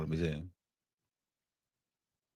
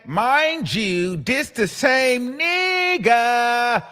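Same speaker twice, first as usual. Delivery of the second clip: reading the tweet with attitude; 8 semitones higher, much slower than usual. Retold or imitated. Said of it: "clapped back and said".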